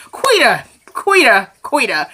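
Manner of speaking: falling, drawn-out name call; exasperated scolding. Real, not acted. Viewer reacting to Quita's behaviour, exasperated. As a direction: scold her by name alone.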